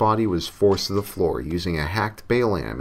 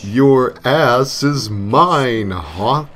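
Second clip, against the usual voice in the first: very slow, much louder and higher in a distorted demon voice; cliche demonic voice; 5 semitones higher, 8 dB louder, much slower than usual. Acted. The devil claiming Hawk, a slow loud demonic voice. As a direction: snarl it out like a cartoon devil claiming his prey. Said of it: "cliche demonic voice".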